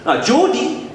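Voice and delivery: high-pitched put-on Geordie; Geordie accent. Shown as a demonstration. Comedian showing off how easy it is, high Geordie accent. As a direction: exaggerate a sing-song Geordie, way up high. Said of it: "Geordie's easy".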